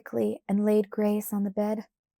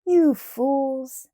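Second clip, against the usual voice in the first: laughed; 4 semitones higher, 6 dB louder than usual. A narrator's reading. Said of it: "Big Jerry laughed".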